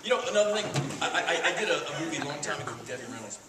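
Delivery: normal talking voice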